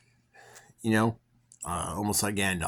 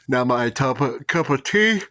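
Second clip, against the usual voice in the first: words pulled high and loud by a real yawn; 7 semitones higher, 10 dB louder than usual. Real, not acted. Vlogger telling the teacher off, yawning mid-line. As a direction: yawn through the phrase. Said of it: "Oh, excuse me".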